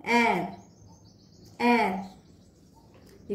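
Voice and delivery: letter R in French. Shown as a demonstration. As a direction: say एयर as French "r", air with a throaty r. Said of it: "pronunciation है". (एयर को French "r" की तरह, गले से र)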